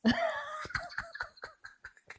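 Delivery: high burst of laughter; real high-pitched giggling fit. Real, not acted. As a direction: crack up at your own impression. Laughing at own Trey impression.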